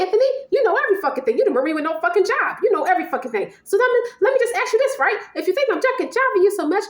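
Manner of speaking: high-pitched, heated ranting; mock-furious lover demanding answers; acted angry tirade. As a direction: tear into your partner, pitch way up. Acted tirade at jobless partner.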